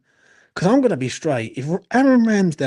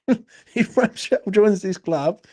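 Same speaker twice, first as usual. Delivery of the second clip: laughing through the words, higher and slower; slow, high-pitched laughing delivery; 5 semitones higher, slower than usual. Real, not acted. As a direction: crack up while trying to get it out. Cracks up restarting the sentence.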